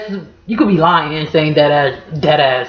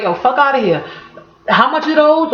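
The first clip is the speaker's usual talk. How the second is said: higher, stunned "fuck outta here"; mock outrage over how much something costs; shocked at a price; 6 semitones higher than usual. Shown as a demonstration. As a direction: balk at the price tag. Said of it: "Or you be like".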